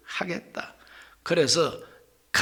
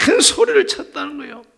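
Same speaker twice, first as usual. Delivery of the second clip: higher and louder, calling out; crying out; 8 semitones higher, 5 dB louder than usual. Shown as a demonstration. Preacher shows the congregation a loud cry to God. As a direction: lift the voice like a shout to God. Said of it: "막 외쳤다는 거예요".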